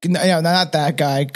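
normal talking voice

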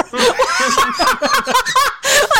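high burst of laughter. Real, not acted. Laughing at awkward chat.

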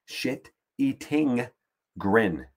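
"Shit eating grin" slow, every word apart, G sounded. Shown as a demonstration. Correcting himself by saying "Shit eating grin" slowly. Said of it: "I gotta say it slower".

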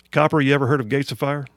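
normal talking voice

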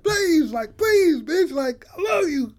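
high mock-crying plea; hammed-up wail, begging in tears; tearful pleading. Man begging at the shut door, mock-tearful. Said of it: "like, in tears".

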